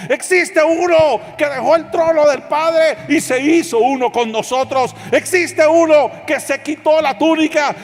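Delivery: high, loud preacher's proclamation; full-force preaching. Real, not acted. Preacher proclaiming Christ to congregation, full-force preaching. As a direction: cry it out in driving, repeated waves.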